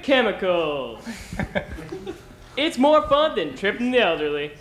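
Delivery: cheesy game show host, upbeat; sleazy charm, playing to the ladies; flirty teasing dating host.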